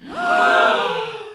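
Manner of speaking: sharp high gasp. A narrator's reading. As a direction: gasp in surprise. Character gasps.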